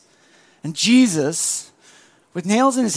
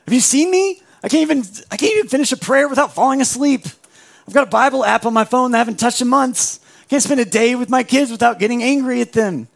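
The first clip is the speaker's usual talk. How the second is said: much higher and fast, disbelieving rant; incredulous protest; 3 semitones higher, faster than usual. Retold or imitated. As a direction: sputter out every failing in a rush. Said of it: "And we're like".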